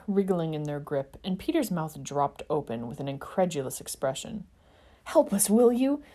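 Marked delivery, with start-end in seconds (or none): 5.0-6.0 urging. A narrator's reading. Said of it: "Remus urged".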